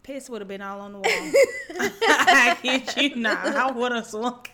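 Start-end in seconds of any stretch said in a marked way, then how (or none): 1.1-4.4 laughing loudly, slow. Real, not acted. Friend laughing at the spider story, loud and slow. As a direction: force the words out through laughter.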